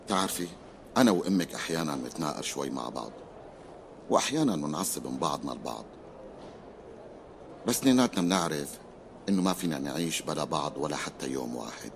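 soft, low, tender; gentle reassurance. Acted. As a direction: comfort her quietly. Father gently reassures daughter. (طمأنة بحنان)

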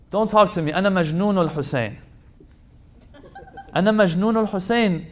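loud, fast zealous chant of the slogan; mock zealot. A preacher mimicking a zealot shouting at his brother. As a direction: shout it like a fired-up devotee.

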